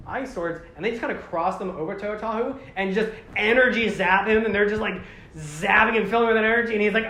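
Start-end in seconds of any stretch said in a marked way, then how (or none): none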